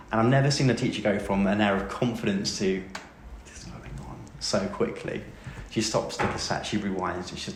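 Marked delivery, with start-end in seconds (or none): 3.4-4.2 soft breathless aside, voice dropping away; stunned whisper. Retold or imitated. Teacher losing confidence during the oral exam, whispered. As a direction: let it fall to a shocked hush.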